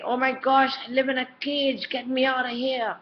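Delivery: mock whiny child begging to be let out; imitating a fed-up kid. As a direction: ham up a kid who feels trapped. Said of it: "They're gonna be saying".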